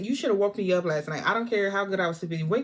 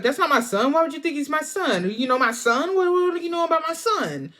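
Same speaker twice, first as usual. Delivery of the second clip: retold defensive outburst; 7 semitones higher, 4 dB louder than usual. Acted defensive outburst at cashier.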